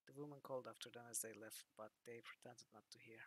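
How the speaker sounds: ordinary talk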